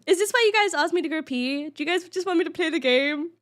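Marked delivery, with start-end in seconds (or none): none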